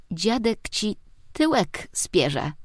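put-on slow, high kid's reproach; a small boy scolding his aunt; child voice. Nephew scolding auntie for swearing, high slow kid voice.

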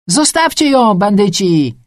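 loud, high cry from the crowd; outraged holler; angry shout. A narrator's reading. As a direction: yell out in protest at the wrongdoers. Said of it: "krzyknął ktoś".

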